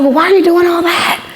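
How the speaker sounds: high, questioning boss voice; puzzled mentor quizzing her; mock puzzled boss. Boss questioning her, acted quote.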